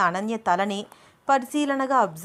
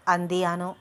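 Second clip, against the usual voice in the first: ordinary delivery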